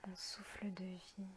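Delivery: half-whispered calm, drawing out each phrase; guided meditation. Acted. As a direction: guide them gently into their breathing, unhurried. Leads a guided breathing meditation.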